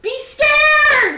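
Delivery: very high, loud mock yell; yelled command. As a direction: scream the order at them. Game master yells at player.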